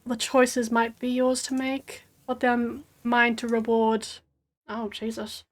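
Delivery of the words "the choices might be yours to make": ordinary delivery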